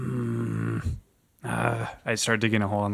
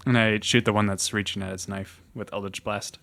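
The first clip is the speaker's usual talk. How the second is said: ordinary talk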